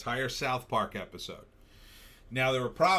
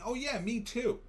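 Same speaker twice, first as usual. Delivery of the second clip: mock eager chime-in; 11 semitones higher than usual. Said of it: "people start throwing out".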